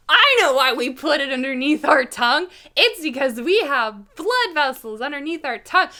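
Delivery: loud, high, proud explaining; eager know-it-all. Retold or imitated. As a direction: show off what you know, loudly. Drugged know-it-all to dentist.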